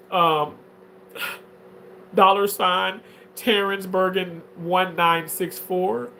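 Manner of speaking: usual speaking voice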